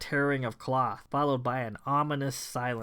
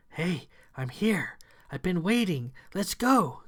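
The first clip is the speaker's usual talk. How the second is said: luring voice; 5 semitones higher than usual. Acted. A disguised creature lures Kay, high coaxing voice. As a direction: lure them in sweetly. False Thomasina calling.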